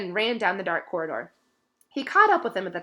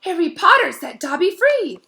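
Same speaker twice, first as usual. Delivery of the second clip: much higher, louder squeaky cry; shrill elf; 10 semitones higher, 10 dB louder than usual. A narrator's reading. Dobby shrilly thanks Harry for freeing him. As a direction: squeal with joy.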